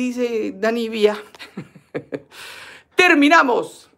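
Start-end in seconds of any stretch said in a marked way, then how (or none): 1.9-2.7 short low chuckle; amused, voiceless little laugh. Real, not acted. Host amused by a viewer's Lindy Lindy vote, chuckling. 3.0-3.9 loud, high announcement; calling out. Real, not acted. Host closes the vote for viewers, calling out loudly.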